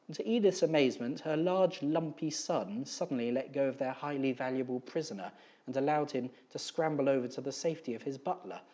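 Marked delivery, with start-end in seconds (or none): none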